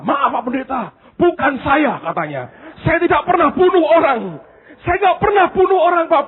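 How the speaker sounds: high, pleading nervous voice; frightened student. Retold or imitated. Scared Indian student answering the pastor, high and pleading. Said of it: "Ini anak ketakutan".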